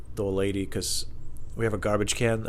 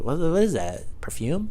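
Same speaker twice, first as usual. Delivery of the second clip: mock burglar puzzled; 7 semitones higher than usual. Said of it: "He's just standing there like".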